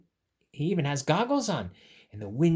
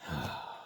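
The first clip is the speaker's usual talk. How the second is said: deep sigh; 13 semitones lower, 11 dB quieter than usual. Real, not acted. Sigh after robot bit.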